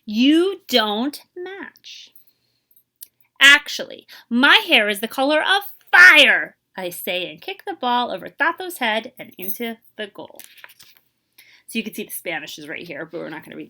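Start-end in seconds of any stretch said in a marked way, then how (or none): 0.0-10.4 lively, expressive picture-book reading; teacher reading a storybook to young kids; reading to children. Acted. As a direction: read it aloud to a class, bringing each line to life. Teacher reads picture book aloud.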